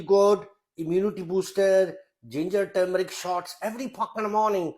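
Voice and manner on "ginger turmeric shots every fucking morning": normal talking voice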